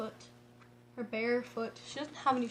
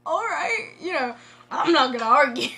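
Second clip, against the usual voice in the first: awkward uncle; 2 semitones higher, 10 dB louder than usual. Retold or imitated. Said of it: "he's just so awkwardly like".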